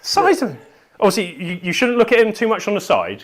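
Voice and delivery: high, loud indignant protest; playfully offended, pitch way up; mock outrage. Mock outrage about his model.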